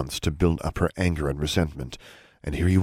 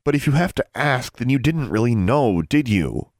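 higher, louder accusing retort; bitter teenage jab, voice raised; angry daughter; 7 semitones higher, 4 dB louder than usual. Acted. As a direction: throw the question back at him. Daughter lashes back at father.

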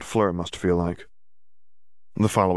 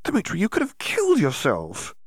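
a touch of horror, voice pitched up; horrified; 13 semitones higher than usual. Acted. Hermione appalled at Dimitri, mildly horrified. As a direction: gasp it out, appalled.

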